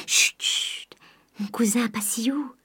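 soft unvoiced shushing; hushing whisper. Eugénie hushes Charles in the sleeping house, whispering. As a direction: hush him so no one wakes.

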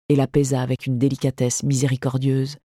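ordinary delivery

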